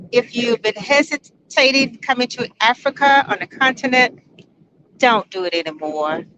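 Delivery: ordinary delivery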